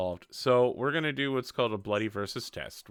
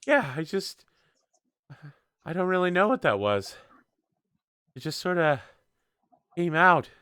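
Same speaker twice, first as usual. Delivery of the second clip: put-on higher, hesitant voice of a recovering girl; shaken, breathless girl; 7 semitones higher than usual. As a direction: play her catching her breath, pitched up. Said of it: "She's just like".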